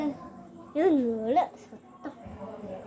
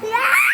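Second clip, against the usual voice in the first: startled yelp; 7 semitones higher, 7 dB louder than usual. Real, not acted. Sudden shock cry.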